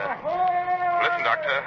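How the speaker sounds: distant, high, megaphone-like call; loudspeaker hail. Acted. The Coast Guard hails the ship through a loudspeaker from a distance.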